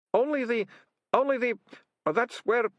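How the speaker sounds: high, halting, nervous acted voice; panicky faltering. Ratcatcher cornered about the other cellar, faltering. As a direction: start and stop in rising panic.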